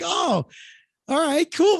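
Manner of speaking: high, light reaction; a little taken aback but friendly; mild amazement. Retold or imitated. Assistant reacting, amused.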